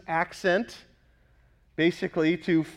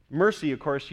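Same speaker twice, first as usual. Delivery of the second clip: usual speaking voice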